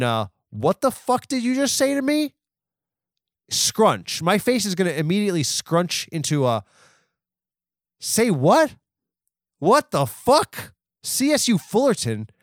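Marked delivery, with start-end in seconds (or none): 0.5-2.3 appalled retort. Retold or imitated. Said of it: "I can't stop my face from crinkling". 8.0-10.7 high, loud, appalled "say what?"; outraged disbelief. Acted. Outraged reaction to the college name. 11.0-12.3 "C S U Fullerton?" thrown back high and scornful; incredulous repeat. Acted. Outraged reaction to the college name.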